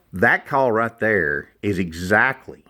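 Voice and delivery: normal talking voice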